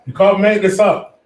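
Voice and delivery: you can't believe it, let it out; exasperated rant about the transfer news; exasperated disbelief. Real, not acted.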